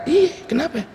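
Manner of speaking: high, surprised teasing voice; amazed classmate. Retold or imitated. Classmate at the reunion, marvelling at how young he looks. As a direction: act the astonished old friend.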